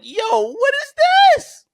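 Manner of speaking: high, disbelieving yelp; shocked fan reacting to an old promo; mock shock. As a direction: squeak it out in disbelief. Retells his shocked reaction.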